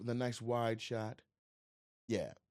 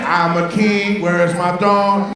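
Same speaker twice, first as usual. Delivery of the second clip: mock rapper boast; 6 semitones higher, 18 dB louder, slower than usual. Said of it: "No, he said".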